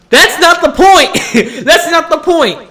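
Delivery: high, loud, drawn-out protest breaking into laughter; exasperated mock shout. Student to persistent file checkers, exasperated mock shout.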